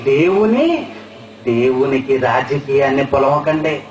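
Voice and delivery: ordinary talk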